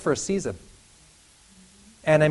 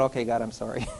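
softer, lower meek sorry; sheepish apology; 6 semitones lower, 9 dB quieter, faster than usual. Acted. Preacher apologizing to God after ranting, meek and soft. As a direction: back down sheepishly.

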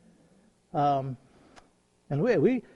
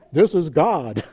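lightly voiced God, a bit louder; mock voice of God; 6 dB louder, slower than usual. Speaker to startled visitor, mock divine announcement. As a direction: announce yourself as a playful God.

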